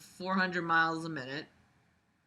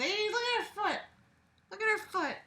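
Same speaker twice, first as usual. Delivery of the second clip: high squeaky voice; 13 semitones higher, 5 dB quieter than usual. Acted. Voicing someone pointing.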